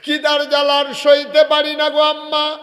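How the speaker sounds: high, loud hungry wail; acted high-pitched anguished cry; child's crying wail. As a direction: cry out to mother at full volume. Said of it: "বাচ্চা কান্দে আর কয়".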